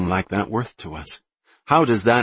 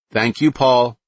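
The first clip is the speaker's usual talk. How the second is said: loud, high call to the kitchen; yelling; 5 semitones higher, 6 dB louder, slower than usual. Acted. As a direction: shout it back with a bite. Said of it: "she yelled to him".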